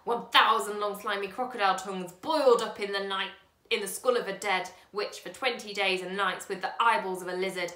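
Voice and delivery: put-on a loud, relentless old man's list of ingredients; old man reciting a magic recipe, loud and wild; loud wild recital. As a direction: proclaim it like a mad spell. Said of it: "he cried".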